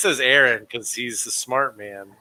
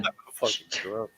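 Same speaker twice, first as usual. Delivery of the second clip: [name] impression; 3 semitones lower, 9 dB quieter than usual. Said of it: "channeling the spirit of [name]".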